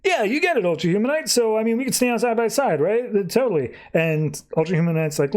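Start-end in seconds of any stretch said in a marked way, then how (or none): 0.0-3.7 high, sarcastic sing-song yeah; mocking yes-man voice; mocking agreement. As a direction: play along with a sneer. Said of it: "And he's like".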